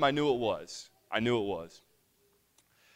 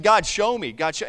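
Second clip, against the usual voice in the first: raised, louder pleading with God; retold urgent prayer, pitched up; doubting plea; 6 semitones higher, 7 dB louder than usual. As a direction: plead upward, insistently. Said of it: "doubting it and saying".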